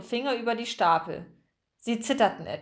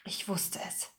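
very soft, whispered realisation; dazed; 11 dB quieter than usual. A narrator's reading. Margarete looking anxiously round the room, dazed whisper. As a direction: breathe it to yourself, stunned. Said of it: "Sie klang benommen".

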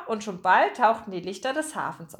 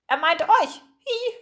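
high, louder gull voice with squeal; squawking seagull; 9 semitones higher, 4 dB louder, slower than usual. Acted. Gull addresses the three musketeers, high squawk. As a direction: speak up as a gull, end on a tiny screech. Gull speaks, ending in a cry.

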